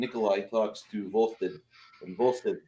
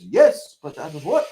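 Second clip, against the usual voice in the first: rabbi voice; 4 semitones higher, 8 dB louder than usual. Acted. Joke rabbi voice.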